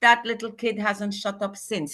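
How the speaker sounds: ordinary talk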